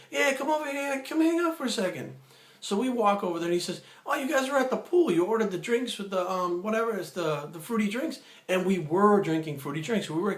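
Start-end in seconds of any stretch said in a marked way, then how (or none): 0.1-2.2 friendly call. Retold or imitated. Salesman calling them over, retold. 4.1-8.2 smooth salesman. Retold or imitated. Retold smooth salesman quote.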